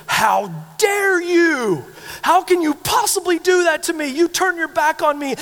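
put-on high, loud furious yelling at the cheating girlfriend; indignant livid shout. Betrayed boyfriend yelling at girlfriend, outraged high loud shout. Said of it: "he is indignant and livid".

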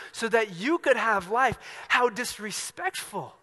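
acted scandalized outburst; indignant rebuke. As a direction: scold with drawn-out, preacherly indignation. Voicing indignant rebuke at himself.

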